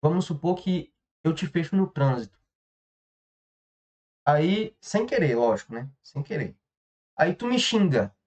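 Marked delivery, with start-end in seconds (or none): none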